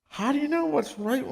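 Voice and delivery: high-pitched, wondering question; amazed questioning. Retold or imitated. Visitor asking counterfeit checkers their secret, amazed. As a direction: pitch it way up, marveling.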